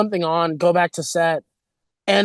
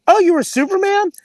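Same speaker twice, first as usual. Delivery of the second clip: surprised question; 12 semitones higher than usual. Retold or imitated. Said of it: "I go".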